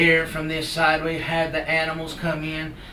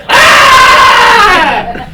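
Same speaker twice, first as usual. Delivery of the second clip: very high, loud, drawn-out scream; falling scream; 21 dB louder than usual. Acted. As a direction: scream as if plunging from a branch. Creature pushed off the tree.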